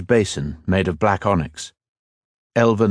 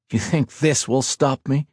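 scoffing; 6 semitones higher than usual. A narrator's reading. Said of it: "Ethan scoffed".

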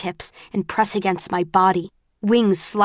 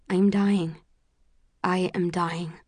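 fading despair; 5 dB quieter than usual. A narrator's reading. Narrator overwhelmed by crows gives up, fading to quiet. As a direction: let the words sink away, weakening. Repeated line fading as she weakens.

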